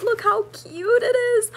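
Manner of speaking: real excited squeal; squealing delight. Real, not acted. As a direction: show it off, thrilled. Showing off the macrame gift.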